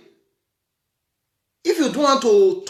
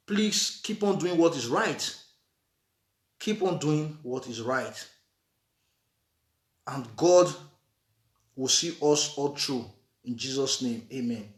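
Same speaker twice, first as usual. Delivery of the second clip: prayerful blessing; 9 semitones lower, 7 dB quieter than usual. Acted. Closing blessing.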